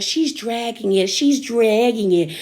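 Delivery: slow, dragged-out drunk imitation; imitated drunk Erica. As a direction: stretch the words like someone tipsy. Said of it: "Erica being drunk is like".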